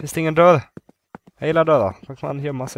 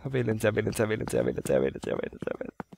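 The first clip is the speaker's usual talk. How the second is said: frightened whimper; 5 semitones lower, 11 dB quieter, faster than usual. Real, not acted. Repeating in fear, fading.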